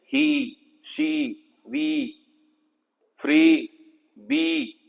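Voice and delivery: ही, शी, वी, फ्री, बी with a long ee; long ee demo. Shown as a demonstration. Teacher lists words with the long ee sound. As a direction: stretch the ee in each word, softly. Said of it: "long ई की sound".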